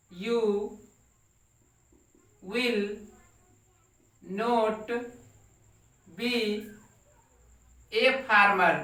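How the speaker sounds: each word set apart by long pauses; slow word-by-word dictation. Shown as a demonstration. Teacher dictating to the class, word by word, slowly. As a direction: dictate it one word at a time for students to copy. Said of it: "teacher dictating word by word".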